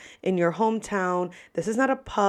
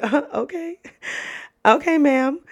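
higher-pitched, taken-aback server; mock-deferential, rising reply; amused disbelief; 7 semitones higher than usual. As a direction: humour the sassy child, stifling a giggle.

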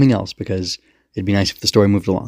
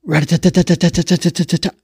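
high rat-a-tat burst; rapid, pitched-up staccato gun noise; imitated gunfire; 9 semitones higher than usual. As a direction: mimic a cannon firing. Imitates cannon fire.

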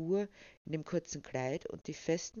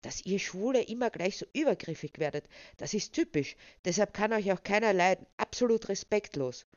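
higher, louder outraged tirade; indignant rant; 4 semitones higher, 6 dB louder than usual. A narrator's reading. Charlie berates Chris for overstepping, indignant and high. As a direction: rail at him, voice pitched up. Rattled man ranting after unwanted advance.

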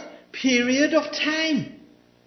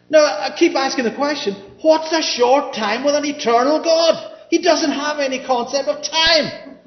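loud preaching building to a shout; impassioned preaching; 3 semitones higher, 6 dB louder than usual. Real, not acted. Preacher argues God is outside time, building to full-force preaching. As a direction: drive the point home, louder and louder.

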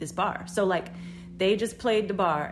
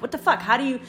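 raised, louder baffled outburst; exasperated disbelief; 5 semitones higher, 4 dB louder than usual. Retold or imitated. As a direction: blurt it out, appalled by the scene. Reacting aghast to his mocking.

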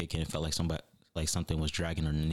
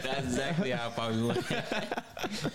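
laughing while agreeing, high; amused, laughing it out; 8 semitones higher, slower than usual. Real, not acted. Agreeing with friend's joke about passing him, laughing.

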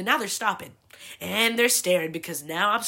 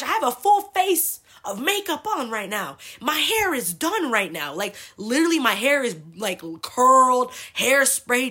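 higher, louder exasperated complaint; pissed-off retelling; 6 semitones higher, 5 dB louder than usual. Retold or imitated. Daughter protesting to her dad with hair and makeup done, acted exasperation. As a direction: argue your case in mock outrage, stretching key words. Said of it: "I tell my dad that I'm fucking pissed".

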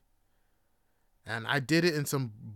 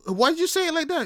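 high, loud, stammered protest; baffled indignation; 12 semitones higher, 6 dB louder, faster than usual. Acted. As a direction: protest in high-pitched disbelief. Acted baffled reply to hikers.

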